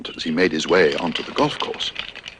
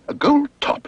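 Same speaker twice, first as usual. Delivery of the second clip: exclaimed. Acted. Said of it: "exclaimed Paddington".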